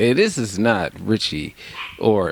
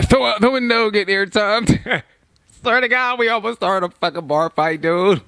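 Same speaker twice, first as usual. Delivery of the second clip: laughing; 10 semitones higher than usual. Real, not acted. Laughing about the fight.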